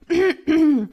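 very loud, pointed throat clear; showy, attention-seeking "ahem"; audible throat-clearing. Acted. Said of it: "räuspert sich vernehmlich".

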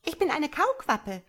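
high little creature voice; young tadpole. A narrator's reading. Tadpole corrects its mother, high childlike voice. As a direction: pipe it up proudly. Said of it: "sagte das Junge".

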